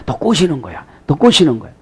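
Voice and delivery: soft, insinuating undertone; 이간질하는 속삭임. Acted. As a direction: plant the doubt quietly, like a tempter. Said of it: "그냥 뭐라고 하면". (남몰래 꼬드기듯 소곤소곤)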